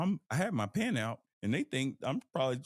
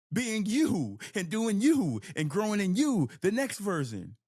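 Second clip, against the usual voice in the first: emphatic push; 6 semitones higher, 5 dB louder than usual. Real, not acted. Emphatic peak of motivational point.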